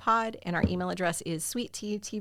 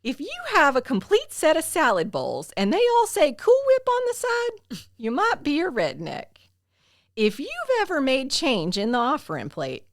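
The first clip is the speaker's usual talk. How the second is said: Jeff Foxworthy voice; 6 semitones higher, 6 dB louder than usual. Retold or imitated. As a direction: imitate a high-pitched Southern stand-up comic. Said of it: "He has a kind of a high-pitched voice".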